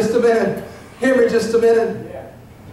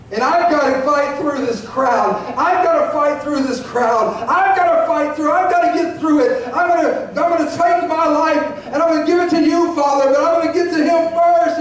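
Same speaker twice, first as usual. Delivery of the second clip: building from resolute to higher, urgent insistence; urgent determination; 5 semitones higher than usual. Acted. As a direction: push through the crowd, pitch climbing. Acts seeker pushing through crowd.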